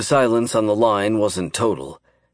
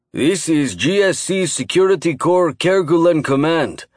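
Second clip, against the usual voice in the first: gruff booming voice; 7 semitones higher than usual. A narrator's reading. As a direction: boom it out like a guard captain. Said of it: "his gruff voice boomed".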